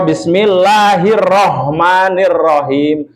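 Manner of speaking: slow, dragged Bismillahirrahmanirrahim; overly slow mistaken recitation; dragged wrong reading. Shown as a demonstration. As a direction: drag it out too slowly. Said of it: "Itu juga sudah keliru".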